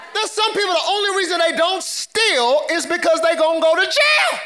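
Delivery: emphatic preacher's cry, pitch swooping up; full-force preaching. Real, not acted. Preacher warning church about looking holy, forceful preaching. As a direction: punch each claim, climbing at the end.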